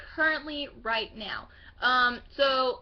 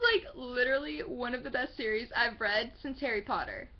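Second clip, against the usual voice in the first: ordinary talk, nothing marked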